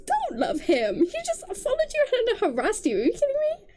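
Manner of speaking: high, incredulous protest; exasperated scolding. Real, not acted. Reader scolding heroine for loving her harasser, high exasperation. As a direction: scold her with the pitch flying up.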